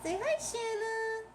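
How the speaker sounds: very high, slow sing-song coaxing. Real, not acted. Coaxing Shanna to greet.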